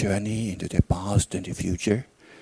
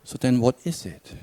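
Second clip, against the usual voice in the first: calm, unhurried meditation guidance with long pauses; guided meditation; 4 dB quieter than usual. Real, not acted. Teacher guides listeners back through a memory, calm meditation voice. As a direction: lead them inward gently, leaving silence between phrases.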